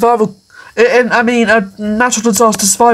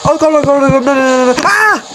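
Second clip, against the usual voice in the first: real panic, high frantic cries; panicking as the storm hits; terrified horror victim; 4 semitones higher, faster than usual. Real, not acted. Storm strikes his game character.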